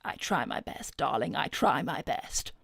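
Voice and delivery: soft, low, half-whispered modesty; coy thanks. Acted. Coyly accepting a compliment.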